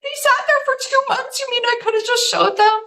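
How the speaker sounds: high, teary disbelief; happy tears on hearing she's published, pitched high; crying with joy. Retold or imitated. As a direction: relive the tears of happy shock. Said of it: "And I'm crying".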